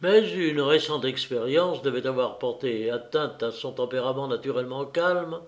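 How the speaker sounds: ordinary delivery, nothing marked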